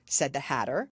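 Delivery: ordinary delivery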